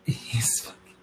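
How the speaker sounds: quick unvoiced whisper aside; gossipy whisper. A narrator's reading. Gossiping to viewers about Brady, hushed whisper. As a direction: drop to a secret whisper.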